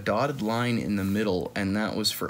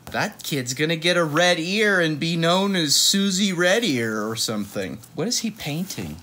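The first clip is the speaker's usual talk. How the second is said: ordinary delivery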